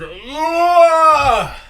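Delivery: long, high, loud frustrated groan; exasperated groan. Real, not acted. Streamer fed up with loading an article, loud groan. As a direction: groan rising into a sustained yell.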